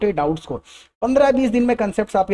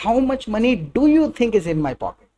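very slow, "you" stressed and falling; careful teacher demo; 2 semitones higher, much slower than usual. Shown as a demonstration. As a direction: say it slowly, hit "you". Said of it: "या फिर".

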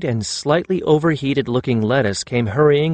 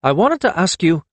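mildly raised, higher call; 6 semitones higher than usual. A narrator's reading. Karun calling to Perry, cut short. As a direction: call over, then break off. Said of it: "he called".

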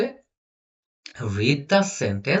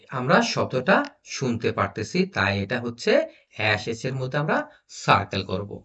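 ordinary talk, nothing marked

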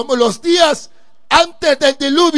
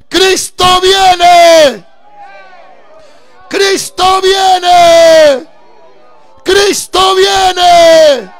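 slow, loud, drawn-out proclamation, higher; a preacher proclaiming, very slow and very loud; preaching at full force; 6 semitones higher, 9 dB louder, much slower than usual. Acted. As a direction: cry it out to the congregation.

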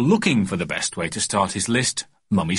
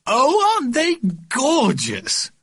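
very high, slow cooing aunt; gushing aunt; 3 dB louder, slower than usual. A narrator's reading. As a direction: swoon over the babies. Aunt gushing over twins.

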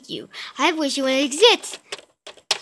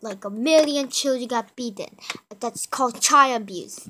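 police officer. Acted. Officer answering kid's call, calm official. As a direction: play a dry police dispatcher.

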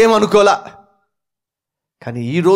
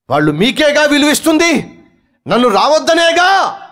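angry shout; 8 semitones higher than usual. Acted. Wife complaining to husband.

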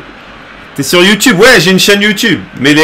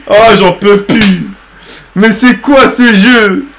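lente lamentation; 3 semitones higher, slower than usual. Real, not acted.

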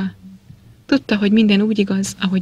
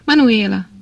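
narrated call for a servant, slightly raised; loud calling; 2 semitones higher, 4 dB louder than usual. A narrator's reading. Harvey calls the servant from the hall, restrained shout. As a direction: call the name out twice, falling at the end. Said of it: "csak hangosan kiáltozott".

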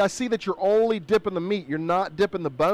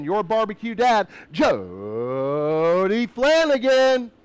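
jolly loud grill dad; 3 semitones higher, 4 dB louder, much slower than usual. Acted. Hype intro of the host.

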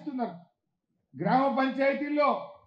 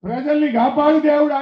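louder, crying out a plea to God for the people; retold loud prayer; 3 semitones higher, 9 dB louder than usual. Speaker retells praying to God for the people, loud plea. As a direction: call on God loudly for the people. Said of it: "క్షమించు దేవుడా అని చెప్పిన నేను".

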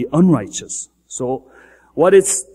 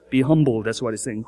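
ordinary talk, nothing marked

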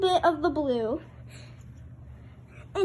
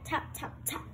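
whispered tap, tap, tap; soft tapping; 10 dB quieter than usual. Acted. Parent whispers the taps while reading to the child. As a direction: breathe the taps out softly.

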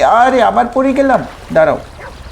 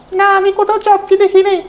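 much higher put-on voice of a neighbour aunt; aunt's voice; 10 semitones higher than usual. Neighbour aunt tells Shinchen she hasn't seen Chop, in a much higher voice. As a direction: answer like a prim neighbour lady.

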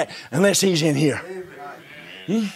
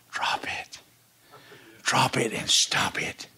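slow, soft whispered plea; hushed urging; 9 semitones lower, 8 dB quieter, slower than usual. Acted. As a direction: press it gently in a whisper. Preacher urges congregation.

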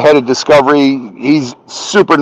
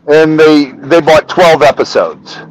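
ordinary talk

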